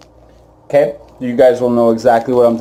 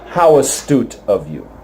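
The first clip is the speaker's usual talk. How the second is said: normal talking voice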